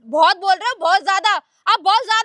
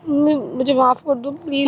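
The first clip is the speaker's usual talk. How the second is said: low, slow, contrite begging; sheepish, remorseful apology; guilty plea; 8 semitones lower than usual. Acted. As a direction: beg mother's forgiveness in a lowered voice. Daughter begs mother's forgiveness.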